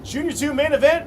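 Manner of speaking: high, loud announcer call; excited. Real, not acted. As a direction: sell the big event. Final lap race call.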